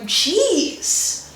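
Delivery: a drawn-out falling "Jeez"; American Jeez. Retold or imitated. Imitating an annoyed American sighing. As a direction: draw out "Jeez" in an exasperated sigh. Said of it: "like when Americans would say".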